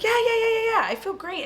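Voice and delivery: high, rapid-fire insisting; overeager reassurance. Retold or imitated. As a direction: insist a little too much. Character overeagerly insisting she's fine.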